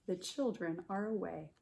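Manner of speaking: normal talking voice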